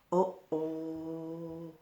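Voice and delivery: low, soft, ominous aside; quiet, drawn-out note of trouble; hushed uh-oh. Acted. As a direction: drop right down for a worried uh-oh. Reader reacts to the threat.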